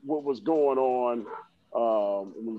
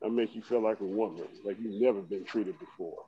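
low, soft bedroom murmur; mock seductive; 5 semitones lower, 6 dB quieter than usual. Host offering to take care of Liz, in a mock-seductive low voice. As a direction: lower and soften it into a joking come-on.

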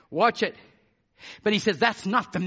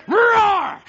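high, very loud roar; lion roar; 13 semitones higher, 8 dB louder than usual. Shown as a demonstration. Lion warning every other champion off his bride, loud roar. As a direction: let out a booming territorial roar. And when he roars.

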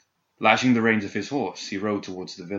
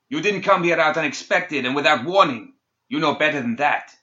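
angry; 6 semitones higher, 5 dB louder than usual. A narrator's reading. Angry quarrel on horseback.

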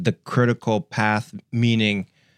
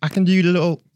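high-pitched, put-on British voice; British accent attempt; 8 semitones higher, 5 dB louder than usual. Shown as a demonstration. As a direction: have a tentative go at British. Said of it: "What's your best British accent?".

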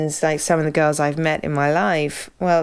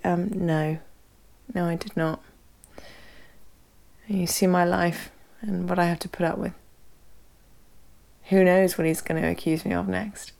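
put-on soft, weary little-girl denial; long-suffering sister; 5 dB quieter than usual. Sister denies it to the congregation, softly and wearily. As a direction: sigh it out like a put-upon sister.

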